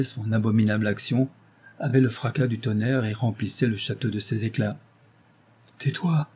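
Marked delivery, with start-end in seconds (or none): 5.8-6.3 higher-pitched, urgent order; tense command to be silent. A narrator's reading. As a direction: hush him at once. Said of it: "ordonna-t-il".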